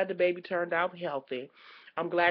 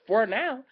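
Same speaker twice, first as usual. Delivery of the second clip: for now punched louder; emphasized for now; 4 semitones higher, 9 dB louder than usual. Shown as a demonstration. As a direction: hit for now hard. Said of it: "and I will keep emphasizing that".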